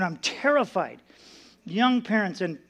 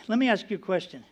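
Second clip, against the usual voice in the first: usual speaking voice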